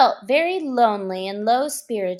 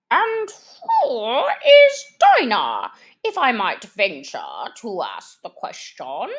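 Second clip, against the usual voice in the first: pompous Lory; 6 dB louder than usual. Acted. Lory asking Alice about Dinah, stately. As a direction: ask with grand politeness.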